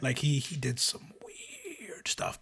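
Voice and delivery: hushed, slow whisper. Real, not acted. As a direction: whisper it like a secret. Conspiratorial aside.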